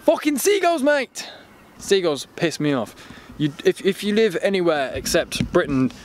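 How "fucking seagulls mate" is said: sudden, high-pitched exasperated cry; annoyed outburst. Real, not acted. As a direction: curse the birds in exasperation. Sudden exclamation at seagulls outdoors.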